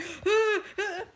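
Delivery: high-pitched panicked gasps; re-enacted frightened gasps; scared gasping. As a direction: gasp in mock terror, high. Retold scare, clinging to stranger.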